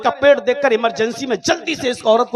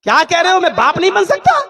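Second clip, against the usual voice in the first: loud, higher shocked disbelief; shocked outburst; 8 semitones higher, 7 dB louder than usual. Acted. Driver crying out in shock at the doctor. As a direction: stagger at the news.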